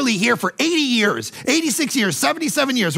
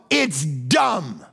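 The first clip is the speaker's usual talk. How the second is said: ordinary delivery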